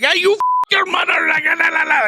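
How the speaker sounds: put-on very high, loud accented outburst; mock scammer tirade. Scammer cursing the prankster who fooled him, high mock rage. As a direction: squawk a garbled stream of insults.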